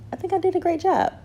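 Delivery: gleeful self-hype; excited squeal. Real, not acted. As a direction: squeal the praise at yourself. Escalated self-praise, squealed.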